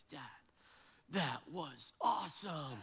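slow, hushed awe with a long pause; imitating his awed son. Son to his dad after the argument, mock awe. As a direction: stretch it out like an amazed kid. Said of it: "my son looked at me".

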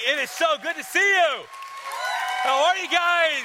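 high, loud greeting to the crowd; loud stage greeting. Real, not acted. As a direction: call out to a cheering congregation.

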